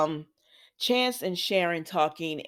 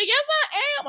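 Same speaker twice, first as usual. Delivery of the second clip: scared Diane. Retold or imitated. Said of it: "She said".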